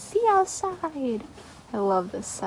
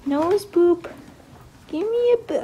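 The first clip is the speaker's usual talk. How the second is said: higher and louder, pleading for a boop; high loud coaxing boop; 8 semitones higher, 5 dB louder than usual. Real, not acted. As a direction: plead playfully for a nose boop. Asking again for boop.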